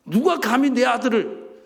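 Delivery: loud, high, drawn-out indignation; angry outburst. Acted. God defending a beloved servant, acted furious challenge. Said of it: "하나님이 막 화를 내는 거예요".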